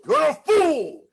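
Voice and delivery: high, loud exclaimed insult; uncle's harsh exclaim. Retold or imitated. Uncle exclaiming the insult at his nephew, loud. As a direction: bark it out like you mean it. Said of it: "When you put emphasis on it".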